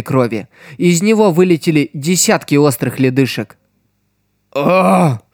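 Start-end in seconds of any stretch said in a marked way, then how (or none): 4.5-5.2 short sharp scream cut off; death cry. Acted. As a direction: scream then stop dead. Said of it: "Крик резко оборвался". (предсмертный крик)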